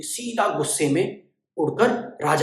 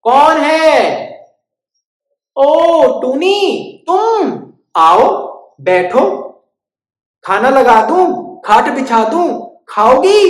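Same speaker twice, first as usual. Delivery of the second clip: high, slow squeaky mouse; sing-song welcome, slow; welcoming mouse; 10 semitones higher, 9 dB louder, much slower than usual. Acted. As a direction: mouse greeting his friend, high and slow.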